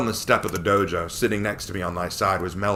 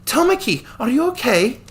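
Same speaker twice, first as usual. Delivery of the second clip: put-on a girl's voice, much higher, concerned; worried girl calling his name, up high; 7 dB louder than usual. Melody checks on the hero.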